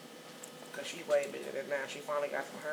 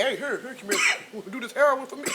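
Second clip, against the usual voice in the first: mock offering; 8 semitones higher, 8 dB louder than usual. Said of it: "How can you be like".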